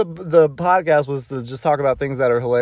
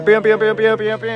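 pew pew sound effects; 9 semitones higher than usual. Acted. Cheering themselves in.

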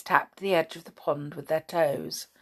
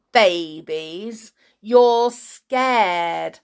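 loud, higher playground jeer; raised-voice bullying dare; yelled taunt; 4 semitones higher, 6 dB louder, slower than usual. A narrator's reading. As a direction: goad them with drawn-out insults. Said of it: "yelled the boy".

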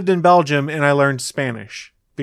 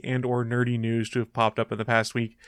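ordinary delivery

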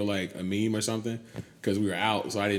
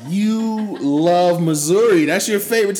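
high, loud mocking tease; sing-song teasing voice; 12 semitones higher, 13 dB louder than usual.

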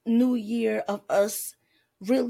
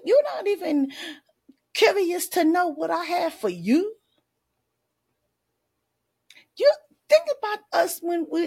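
higher and louder, indignant; God scolding; 9 semitones higher, 4 dB louder than usual. Acted. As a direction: scold them as God would, slowing on ungrateful. God scolds the ungrateful child.

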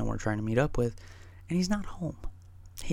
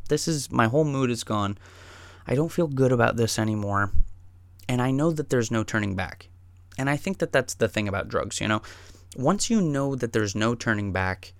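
ordinary delivery, nothing marked

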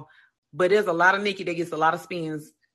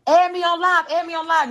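imitating Meg, higher and pleading; a hint of an eager fan asking; mock eager plea; 7 semitones higher than usual. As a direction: beg to join like a needy fan. Voices Meg begging in comments.

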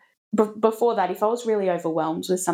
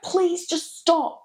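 higher, slow, drawn-out please; retold pleading yell; 11 semitones higher, much slower than usual. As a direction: stretch it out like a worn-out parent. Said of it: "I was yelling at Willa".

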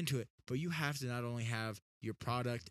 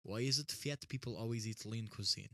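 retold line in mock Russian; deadpan put-on Russian accent. Retelling a Walmart remark to sister, mock Russian accent. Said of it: "this, like, Russian accent thing going".

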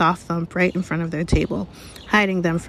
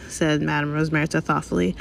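usual speaking voice

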